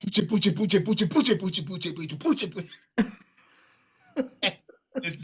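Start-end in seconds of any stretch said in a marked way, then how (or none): 0.0-2.7 higher, chanted "puche" drum noises; imitated drum beat. Drummer mocking his own bad playing with drum noises. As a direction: mouth the clumsy drum groove, higher.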